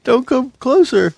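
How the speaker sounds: high, slow frightened plea; scared pleading. Acted. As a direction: beg them to stay back. Hostage pleading.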